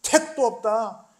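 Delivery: loud, high anguished proclamation; prophet's tearful cry, building to full force. Acted. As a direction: Jeremiah crying out his warning. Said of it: "에레미야는 눈물로 말합니다".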